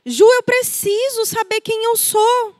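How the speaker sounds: high, plaintive confession; lost and pleading. Retold or imitated. A client confesses to the therapist, lost and plaintive. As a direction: sound lost, not knowing yourself. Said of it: "elas falam".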